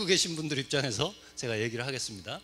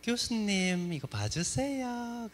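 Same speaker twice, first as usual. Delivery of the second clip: Daegu woman's sweet drawn-out plea, higher and slow; Daegu dialect; 6 semitones higher, much slower than usual. Retold or imitated. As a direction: Daegu student asking the teacher to look at her drawing, sweet dialect. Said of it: "사투리가 되게 예뻐요".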